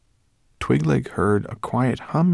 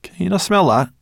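Sorrel voice; 11 semitones higher, 8 dB louder than usual. Acted. Sorrel sniffing, alarmed question.

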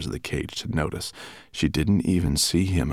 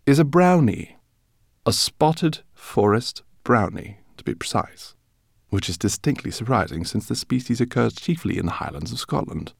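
a lightly voiced gentle professor; calmly correcting his colleague; lightly voiced calm scholar; Greenbloom; 6 semitones higher than usual. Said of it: "he said quietly".

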